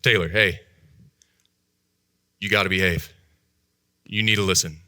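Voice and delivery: low-key firm warning; stern grandpa. Retold or imitated. Grandpa telling Taylor in the doctor's office to behave, mildly stern. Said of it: "My grandpa put on a stern voice".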